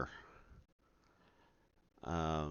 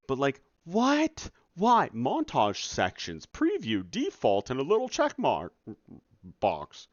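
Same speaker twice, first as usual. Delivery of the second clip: higher, louder, incredulous listing; exasperated rant; 7 semitones higher, 7 dB louder than usual. Real, not acted. Dev listing absurd menu steps to viewers, incredulous and loud. Ranting again about montage menu.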